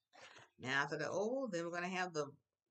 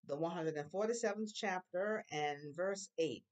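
usual speaking voice

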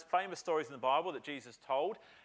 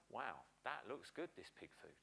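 hungry son; 6 semitones lower, 10 dB quieter than usual. Retold or imitated. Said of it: "he looks at the pig food and he goes".

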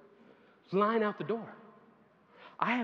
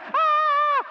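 loud high crowd roar; crowd cheer; 13 dB louder than usual. Retold or imitated. Crowd erupting in a loud cheer. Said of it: "the whole crowd was like".

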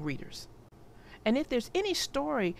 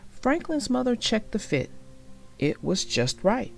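ordinary delivery

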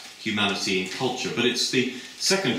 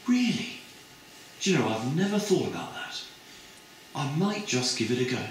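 high, airy and eager; a girl won over, voice lifting; breezy high I might just give it a go; delighted student; 6 semitones higher than usual. Retold or imitated. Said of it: "And her friend said".

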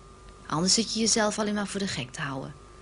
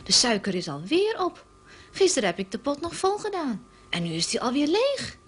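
Barbara, higher and amazed at the empty sugar pot; wide-eyed disbelief, pitch lifted well up; surprised; 6 semitones higher than usual. A narrator's reading. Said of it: "zegt Barbara verbaasd".

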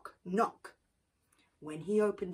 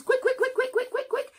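high, louder rapid chant; quick chirps; 7 dB louder, faster than usual. A narrator's reading. Fast hand washing.

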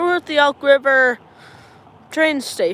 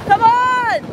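excited shout; 10 semitones higher, 5 dB louder than usual. Real, not acted. Calling for the train.

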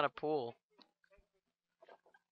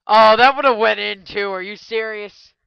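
loud, higher cry of disbelief; can't believe it nearly dropped; a loud, higher, then slower outburst of disbelief; surprised outburst; 5 semitones higher, 12 dB louder than usual. Real, not acted. Shot almost went in.

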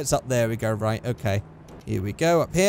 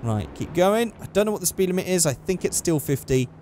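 ordinary delivery

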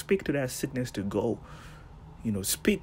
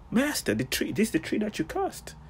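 mock amazement; 10 semitones higher than usual. Disciples amazed at withered tree.

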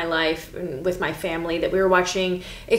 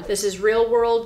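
normal talking voice

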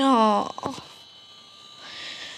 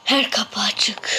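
slow nervous whisper; 2 semitones lower, slower than usual. Real, not acted. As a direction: breathe the words out. Tense whisper in game.

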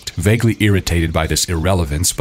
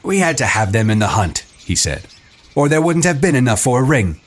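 defensive; 7 semitones higher than usual. A narrator's reading. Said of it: "He flushed, conscious of a fault".

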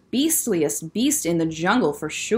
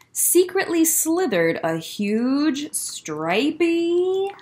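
slow suspense; 6 semitones higher, much slower than usual. A narrator's reading.

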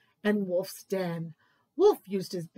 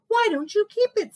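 much higher, louder and fast; eager mole; 10 semitones higher, 6 dB louder, faster than usual. Acted. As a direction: offer it quickly and brightly. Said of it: "said Mole".

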